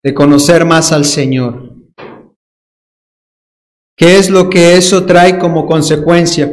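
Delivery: normal talking voice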